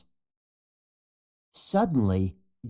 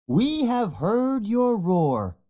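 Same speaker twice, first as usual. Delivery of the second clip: put-on high, slow, excited old sage; 9 semitones higher, slower than usual. A narrator's reading. Wise mandrill excitedly greeting the young lion. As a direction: announce it with wonder. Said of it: "Rafiki says excitedly".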